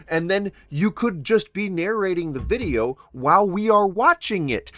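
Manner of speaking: ordinary delivery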